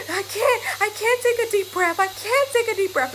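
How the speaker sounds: daughter's mock crying fit. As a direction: imitate a little girl sobbing she can't breathe. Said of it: "she's like".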